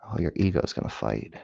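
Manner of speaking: ordinary talk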